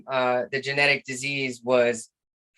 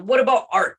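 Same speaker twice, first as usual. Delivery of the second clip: eager suggestion; 8 semitones higher, 6 dB louder than usual. Retold or imitated. Cheering up suicidal old man.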